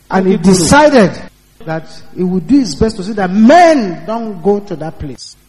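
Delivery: normal talking voice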